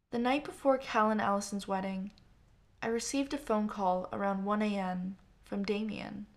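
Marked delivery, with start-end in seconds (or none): none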